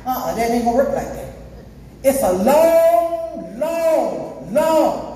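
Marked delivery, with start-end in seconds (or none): 2.0-5.1 "long" stretched very slow and raised high; drawn-out LONG. Real, not acted. Preacher describes eternal burning, each "long" drawn out. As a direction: hold each "long" as if it never ends.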